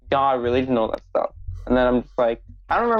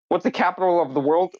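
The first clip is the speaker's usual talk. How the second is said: mock clueless American; 6 semitones higher than usual. Mocking ignorant American.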